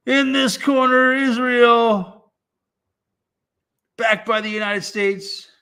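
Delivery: high, loud hammed-up ring announcer; boxing ring announcer. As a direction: announce the fighters to the crowd.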